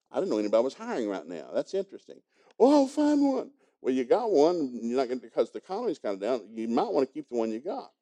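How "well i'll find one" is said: upset daughter. Retold or imitated. Daughter answers father.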